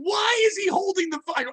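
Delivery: high-pitched incredulous mock outburst; exasperated boss. Boss questioning why the kid holds the phone, incredulous mock outburst. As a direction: imitate a boss who can't believe this.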